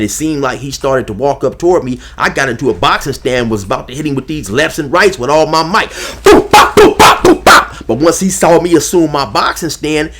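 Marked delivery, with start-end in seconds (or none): 5.9-7.9 punch sound effects. Retold or imitated. Imitating his punches.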